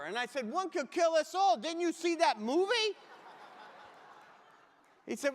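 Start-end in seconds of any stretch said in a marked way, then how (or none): 0.5-2.9 high, loud hammed-up alarm; mock panic. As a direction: play the terrified guest begging the clerk. Mock panic on hotel phone.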